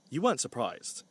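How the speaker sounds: ordinary delivery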